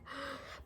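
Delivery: quick unvoiced gasp of realization; sharp gasp. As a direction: gasp in on the breath, as if it just hit you. Mimicking her sudden realization.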